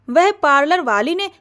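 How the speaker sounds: plain reading voice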